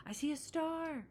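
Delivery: high, delighted call; excited cry. Acted. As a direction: point it out with wonder. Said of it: "cried Sister".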